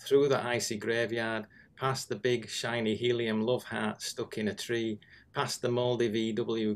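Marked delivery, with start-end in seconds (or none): none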